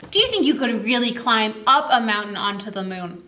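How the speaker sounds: usual speaking voice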